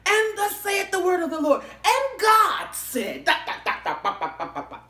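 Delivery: high, loud mock preacher shout; mock revival preacher calling down heaven; preaching in tongues. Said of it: "I'm preaching, praying in tongues".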